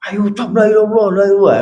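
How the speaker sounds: hurried prompting. Shown as a demonstration. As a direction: rush him to say it. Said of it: "ketergesa-gesaan".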